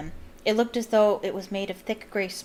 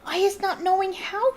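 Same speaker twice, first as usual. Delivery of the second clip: gasping house-elf; 11 semitones higher, 3 dB louder than usual. A narrator's reading. Winky pleads with Mister Diggory, frightened squeaky elf. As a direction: stammer it out, terrified. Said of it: "Winky gasped".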